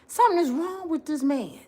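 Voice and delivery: fast, hushed mock lecture; mock scolding. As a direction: scold him like a baffled friend. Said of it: "I said".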